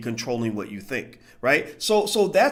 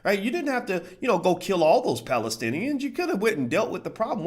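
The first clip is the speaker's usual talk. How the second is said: usual speaking voice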